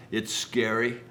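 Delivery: ordinary talk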